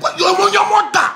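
high, sudden shouted curse; angry insult. Acted. Ranting host cursing people who question his talk, angry shout. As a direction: snap into a loud, high-pitched outburst.

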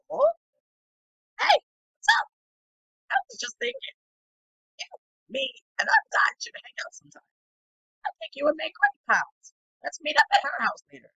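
high, loud squeaky-pitched game character. Acted. Inviting someone to hang out in a high character voice. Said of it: "the voice I gave to him".

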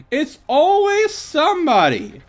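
high, loud, slow wail; dramatic high shout. Pretend. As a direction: stretch the wail out melodramatically. Repeated dramatic outburst.

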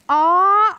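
high, loud, swelling "oh"; overcome, rising "oh"; moved exclamation. A narrator's reading. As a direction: let out a heartfelt cry of sympathy. Wendy is moved, reaches out.

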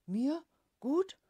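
loud, high, outraged roar. A narrator's reading. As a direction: bellow it in disbelief. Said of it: "brüllte Vater". (laut und hoch gebrüllt, empört)

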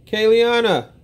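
loud high call of her name. Real, not acted. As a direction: shout her name through the house. Dad calls child's name.

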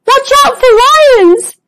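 high-pitched, anxious cry of warning; a nervous little zebra sounding the alarm. Acted. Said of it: "looking around anxiously".

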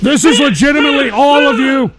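loud, high shout over the clip; exasperated shout. Real, not acted. Host berating his listeners over a clip, shouting. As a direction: yell it as an accusation at everyone.

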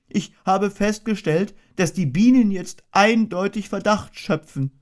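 a little bear anxiously asking, then shrugging; worried Pooh. A narrator's reading. Pooh greets boy returning.

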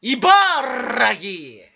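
茨城 shouted with a long rolled R. Acted. Streamer playfully announces 茨城, loud trilled R. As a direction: roll the R hard and loud in 茨城. Comic shout of prefecture name.

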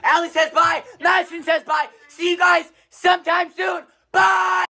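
loud high excited outro; hyped shouting. Real, not acted.

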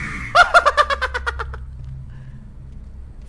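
high, airy burst of laughter; high breathless laugh. Real, not acted. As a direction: let out a breathy laughing shriek. Laugh while walking in.

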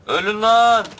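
high, loud snap of a war cry; "Ölün lan" shouted short and loud; mock war cry. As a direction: yell it at the enemies as you charge. Charging enemies alone in game.

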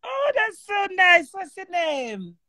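high, slow gushing "Oh, that's so nice". Real, not acted. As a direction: coo it way up high, drawn out. Gushing to soldier caller.

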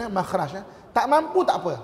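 ordinary talk

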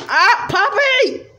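very high, slow wailing cry of Poppy; wailing call. Acted. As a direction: wail her name in despair. Crying character calls Poppy.

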